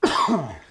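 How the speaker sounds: sharp choking cough; coughing up water. Real, not acted. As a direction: cough as water goes down the wrong pipe. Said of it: "Water went down the wrong pipe".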